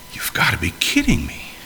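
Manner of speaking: breathy muttered whisper under his breath. Retold or imitated. Abraham walking away, muttering to himself in a whisper. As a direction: mutter it to yourself, nearly voiceless. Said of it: "muttering to himself".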